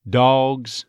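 "Dogs" as "dawgs"; slightly rounded vowel. Shown as a demonstration. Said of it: "that slightly rounded sound".

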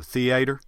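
"theater" as thee-AY-ter, middle syllable stressed; shifted Southern stress. Shown as a demonstration. Dialect coach demonstrates Southern stress, "theater" said thee-AY-ter. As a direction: move the beat to the middle of "theater". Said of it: "the stress on words can actually shift around".

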